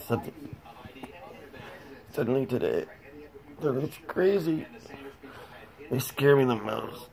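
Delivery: barely getting words out; breathless whisper. Real, not acted. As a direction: voice failing, breathy and faint. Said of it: "It's hard to talk after".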